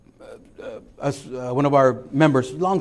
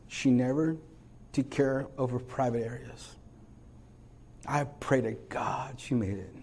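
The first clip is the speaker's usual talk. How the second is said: soft, intimate preaching; hushed sermon close; 6 dB quieter than usual. Acted. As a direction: draw them in gently, quietly. Quiet altar-call intimacy.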